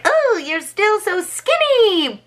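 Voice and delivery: very high, drawn-out witch complaint; whining witch. Retold or imitated. Witch disappointed that Hansel is still skinny, high whine. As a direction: stretch it into a high mock lament. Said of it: "She's like".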